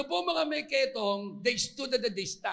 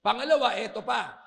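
ordinary delivery

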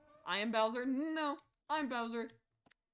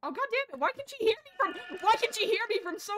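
high, loud frustrated outburst; loud, high complaint at an unfair game; exasperated yelling; 9 semitones higher, 7 dB louder than usual. Real, not acted. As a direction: yell in outraged disbelief, pitch way up. Exasperated at being trapped in game.

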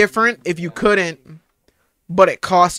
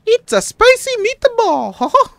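cartoon Mickey voice. Cartoon voice bit.

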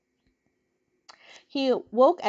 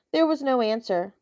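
usual speaking voice

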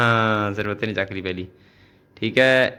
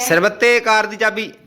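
calling out the item; 7 semitones higher, 3 dB louder, slower than usual. Real, not acted. Calling out items in game.

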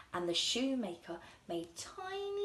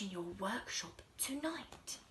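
soft unvoiced whisper; 3 semitones lower, 8 dB quieter than usual. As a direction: whisper the secret plan. Planning a secret watch.

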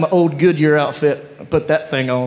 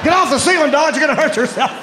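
mock call up; 10 semitones higher than usual. Shouting up to Dodge.

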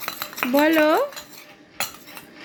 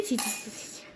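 soft breathy whispered "ছি, ছি"; whispered scolding tut; 7 semitones lower, 14 dB quieter, faster than usual. Real, not acted. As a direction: drop to a whisper while tutting. Parent tutting at child's mischief.

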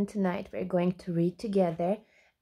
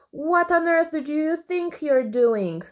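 annoyed zebra; 7 semitones higher, 3 dB louder than usual. A narrator's reading. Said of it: "asked the zebra".

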